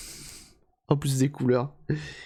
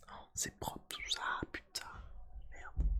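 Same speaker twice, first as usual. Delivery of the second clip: whispered aside; 19 dB quieter than usual. Real, not acted. Muttering to himself while fixing console.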